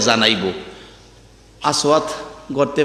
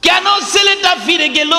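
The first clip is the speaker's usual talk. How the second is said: high, chanted question to father; daughter's urgent plea; 12 semitones higher than usual. Acted. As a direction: call out to father, pitch soaring.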